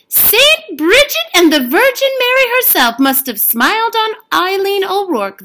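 high, loud gossiping woman; village woman. A narrator's reading. Village woman marvelling that Eileen finally rests, high and bright. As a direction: exclaim like a delighted village gossip. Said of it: "the village woman said".

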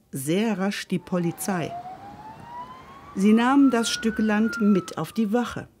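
usual speaking voice

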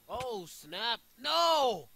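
sudden high, loud rage-quit scream; real high-pitched yell of dismay. Real, not acted. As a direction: scream it out as the game goes wrong. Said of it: "Sorry about my screaming".

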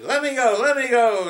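high, loud frantic plea; panicked cry. Acted. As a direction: yank free, begging. Rabbit stuck in the tar.

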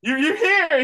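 high, loud excited squeak; little robot overjoyed, loud and high. Retold or imitated. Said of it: "the robot was so excited".

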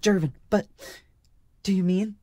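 very high, loud, startled halting words; exclaiming. A narrator's reading. Marka sensing Jervin's disappointment, exclaiming in surprise. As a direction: break off in dawning realisation. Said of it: "Marka exclaimed".

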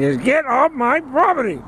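high-pitched imitation of a yelling neighbor; retold yelling. Neighbor repeating his order to leave, high mock yelling. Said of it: "he kept saying".

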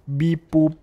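beeping robot sounds. Pretend. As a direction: chirp it like a toy robot. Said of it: "ich bin ein Roboter".

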